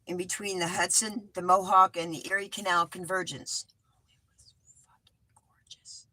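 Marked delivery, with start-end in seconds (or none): 3.7-6.1 breathy hush with no voice behind it; whispering so the live stream can't hear the curse. Real, not acted. To viewers, whispering the censored part. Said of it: "I, I whisper".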